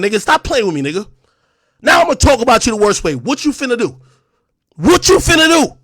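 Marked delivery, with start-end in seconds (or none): 4.8-5.8 louder, higher confrontational demand; angry challenge. Real, not acted. Streamer daring a rival to act, angry challenge. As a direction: throw the challenge in their face.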